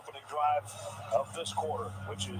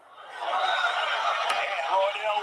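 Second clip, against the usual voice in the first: excited touchdown call; 6 semitones higher, 8 dB louder, much slower than usual. Real, not acted. Touchdown call in live broadcast.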